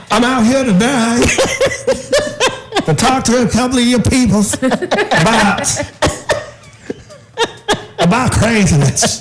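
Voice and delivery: lightly voiced street preacher; hint of a soapbox preacher, laughing. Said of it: "You sound like the State Street preacher".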